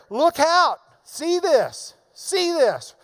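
loud, high repeated commands; emphatic Paul. Acted. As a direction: stress look out and see this. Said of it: "Paul's got emphasis there".

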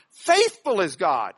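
"Faithful" loud and slow at the front; emphatic. Shown as a demonstration. Preacher says Paul's emphatic on it, heavy stress on "Faithful". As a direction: land hard on "Faithful". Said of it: "Paul's emphatic on it".

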